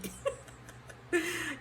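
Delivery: a burst of airy laughter, pitched high; high breathy laugh. Real, not acted. Laughing at her own impression of the runner for her viewers.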